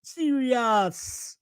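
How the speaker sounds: "Serious" drawled softly, Jamaican-lilted; [name] impression. Host drops a Jamaican-accented "Serious" like [name]. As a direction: say "Serious" slow and soft like a Jamaican. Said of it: "as [name] would say".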